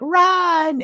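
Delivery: high, loud imitated cry; mock warning shout. Retelling her freeing the unicorn, mock warning shout.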